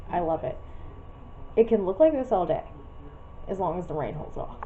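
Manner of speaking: usual speaking voice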